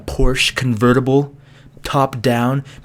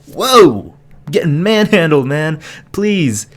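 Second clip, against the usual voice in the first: mock alarm; 4 semitones higher, slower than usual. Retold protest at being measured.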